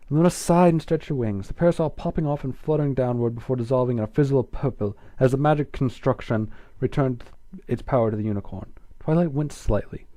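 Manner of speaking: ordinary delivery, nothing marked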